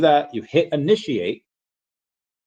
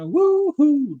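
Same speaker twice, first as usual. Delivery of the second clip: excited woohoo. Acted. Streamer reading a fan's cheer, whooping high.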